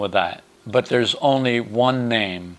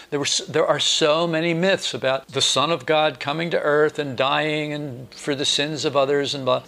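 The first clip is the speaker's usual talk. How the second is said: usual speaking voice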